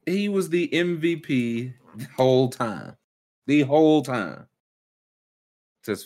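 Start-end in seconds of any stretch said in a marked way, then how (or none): 0.1-4.5 slow dramatic reveal. Real, not acted.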